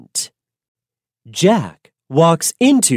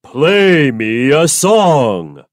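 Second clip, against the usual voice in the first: commanding giant; slower than usual. Acted. Giant ordering the golden harp to play, slow. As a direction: order the harp like a lazy king.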